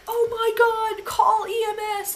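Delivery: high, loud mimicked alarm; mock panicked shout. Bystanders calling for EMS, mock panicked shouting. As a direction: shriek it like an overreacting bystander.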